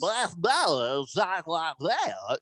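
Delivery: slow, drawn-out mock announcer voice; overdone parody of a try-hard broadcaster; sportscaster "puker" voice. Co-host mocking a sportscaster's delivery, puker voice. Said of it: "She tries too hard".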